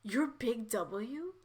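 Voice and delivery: hushed, halting disbelief; acted quiet shock; stunned dread. A narrator's reading. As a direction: stammer softly, then draw the question out slowly. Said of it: "her face becoming pale".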